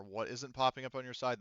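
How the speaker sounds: normal talking voice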